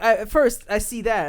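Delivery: ordinary delivery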